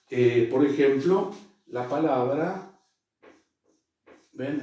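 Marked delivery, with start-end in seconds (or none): none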